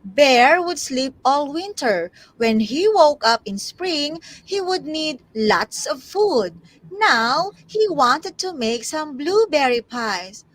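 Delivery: put-on animated storybook narration for children; story reading. Tutor takes her turn reading to class, animated storytelling. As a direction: read aloud with swinging, emphatic phrasing.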